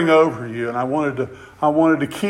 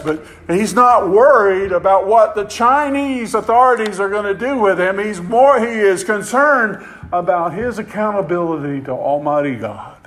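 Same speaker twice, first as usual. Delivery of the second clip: higher and louder, preaching at full force; fiery preaching; 7 semitones higher, 6 dB louder than usual. Acted. Preacher rousing his congregation about an arrested pastor, fiery full-voiced preaching. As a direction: drive it home to the congregation.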